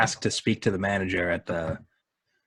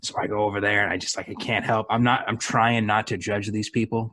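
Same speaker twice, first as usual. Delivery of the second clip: usual speaking voice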